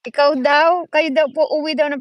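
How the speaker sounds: loud call relaying a message; loud relayed call. Real, not acted. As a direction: raise the voice so they hear from the next room.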